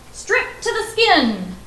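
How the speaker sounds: high, slow stern command. A narrator's reading. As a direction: bark the order slowly. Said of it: "the emperor commanded".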